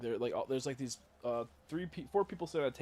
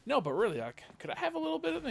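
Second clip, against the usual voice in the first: high-pitched, loud mock plea; hammed-up asking, climbing high and rushing; imitating pleading professor; 7 dB louder, faster than usual. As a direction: wheedle for a bite, voice way up. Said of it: "he goes".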